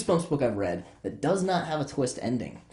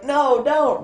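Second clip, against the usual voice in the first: high, loud acted protest; pleading cry; 12 semitones higher, 7 dB louder than usual. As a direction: cry out to stop them. Ghost pleads with the boys.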